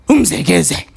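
low answer. Acted. Call and response chant.

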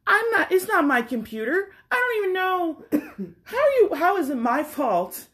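climbing in pitch, baffled and fed up; exasperated inner rant. Retold or imitated. To the pharmacist in her head, exasperated and rising.

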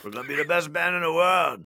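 high, excited Aussie rock star; Australian accent. Retold or imitated. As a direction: hype it up like an Aussie frontman. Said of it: "He was like".

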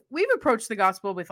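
ordinary talk

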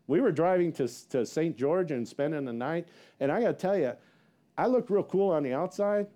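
normal talking voice

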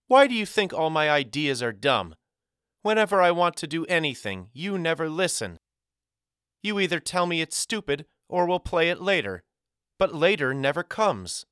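put-on high-pitched girl's indignant complaint; upset outburst. A narrator's reading. Girl rebuking the boy who dismisses her ideas, upset. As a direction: complain bitterly, pitched well up throughout.

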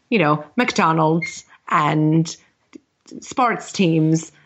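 hammed-up mock Irish brogue; terrible Irish accent. Co-host answering on Shadow's constant surprise, terrible Irish accent. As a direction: play up a bad Irish accent. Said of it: "what do you think in your terrible Irish accent".

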